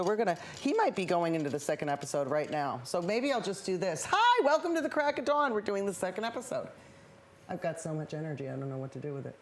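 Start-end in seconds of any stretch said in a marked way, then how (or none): 4.1-5.5 host intro. Acted. Restarting show intro.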